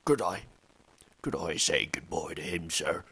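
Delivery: big man, choked-up request; a hint of tears, loud; tearful Hagrid. A narrator's reading. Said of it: "asked Hagrid".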